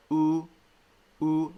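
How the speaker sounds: "oo" with lips extended; long U sound. Shown as a demonstration. Teacher recalling long U for learners, extended "oo". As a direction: hold an isolated, rounded "oo". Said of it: "with a very extended mouth".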